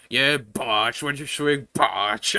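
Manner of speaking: teasing put-on voice; mock critique. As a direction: needle him in a put-on voice. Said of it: "like, you critique".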